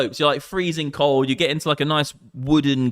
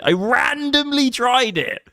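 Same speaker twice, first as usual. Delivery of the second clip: much higher and slower, hammed up; mock dramatic repeat; 12 semitones higher, slower than usual. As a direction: replay the line as a big reveal. Dramatic repeat, friend laughs.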